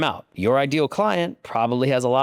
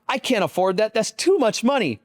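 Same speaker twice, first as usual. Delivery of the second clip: panicked; 9 semitones higher than usual. Retold or imitated. Retelling panic at price.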